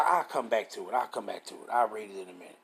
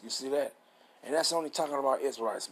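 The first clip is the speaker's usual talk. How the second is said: usual speaking voice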